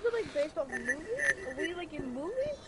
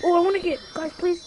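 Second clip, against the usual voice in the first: loud pleading; 5 semitones higher, 11 dB louder than usual. Real, not acted. Begging friends to wait.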